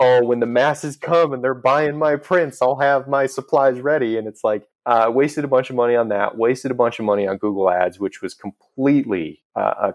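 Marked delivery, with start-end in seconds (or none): 0.0-4.2 higher-pitched mock of a hopeful younger self; naive optimism. Recalling stock piled in parents' garage, mock naive optimism. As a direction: imitate your deluded, eager younger self. Mocking own younger daydream.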